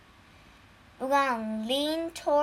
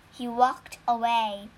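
ordinary delivery

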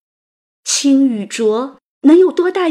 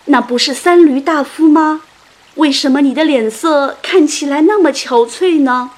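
louder, raised storyteller's call; calling out loudly; 4 semitones higher, 6 dB louder than usual. A narrator's reading. Fisherman hails Qu Yuan, calling out loudly. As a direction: call out in surprised question. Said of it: "一个渔夫大声喊道".

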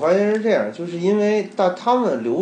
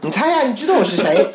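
higher, lilting guess-who voice; teasing caller voice; 7 semitones higher, 3 dB louder than usual. Retold or imitated. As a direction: tease him, make him guess. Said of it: "然后我说".

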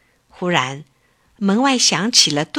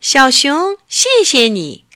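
louder, higher rooster thanks; shrill rooster voice; 6 semitones higher, 7 dB louder than usual. Acted. Rooster thanks the bear in a sharp high voice. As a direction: crow your thanks sharply. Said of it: "用尖尖的声音说".

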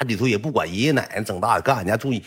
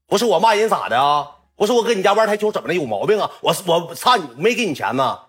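put-on drunk defiant retort; 8 semitones higher, 3 dB louder than usual. Drunk Yong snaps back.

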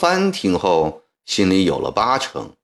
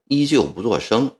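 ordinary delivery, nothing marked

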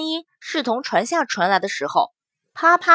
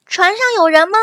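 raised voice calling into the empty ship; 6 semitones higher, 4 dB louder, faster than usual. Acted. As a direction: call out loudly. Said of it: "祸水大喊了一声".

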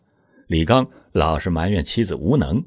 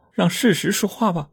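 higher woman's voice, a touch tearful and resolute; teary resolve; 11 semitones higher than usual. A narrator's reading. Xiulan answers her husband, tearful and resolute. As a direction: grit your teeth through tears. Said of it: "秀兰哭着一咬牙".

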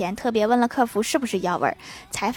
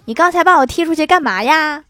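higher, louder indignant snail; snail scolding; 5 semitones higher, 6 dB louder than usual. Acted. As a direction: yell it at him like an offended snail. Snail scolds Xiaoming at door.

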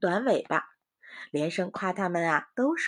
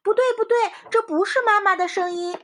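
very high, loud no-no; alarmed warning; 12 semitones higher, 7 dB louder than usual. A narrator's reading. Long Ears holds back his siblings, very high and loud. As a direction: stop them sharply.

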